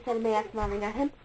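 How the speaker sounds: plain reading voice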